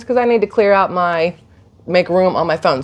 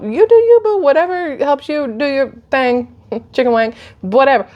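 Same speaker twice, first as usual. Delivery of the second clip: sassy; 7 semitones higher than usual. Real, not acted. Sassy dismissive aside to viewers.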